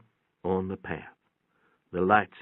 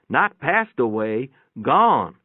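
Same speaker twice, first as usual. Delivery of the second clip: higher, louder, the word gone leaned on hard; nurse insisting; 4 semitones higher, 6 dB louder, slower than usual. A narrator's reading. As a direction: hammer home that she ran off. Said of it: "the nurse said".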